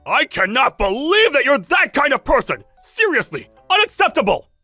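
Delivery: indignant, appalled telling-off; indignant outburst. Acted. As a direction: berate a spouse in disbelief. Husband berates wife over her food.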